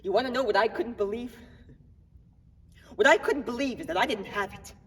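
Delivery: acted incredulous outburst, punching the short phrases; agitated disbelief. Man telling cops about his negative test, agitated.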